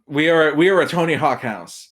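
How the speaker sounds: ordinary talk, nothing marked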